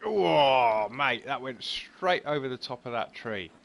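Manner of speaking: loud oh, mate at the ball; real dismay as it clears the tree; shocked exclamation at a bad shot; surprised outcry. Real, not acted. Ball flies over the tree.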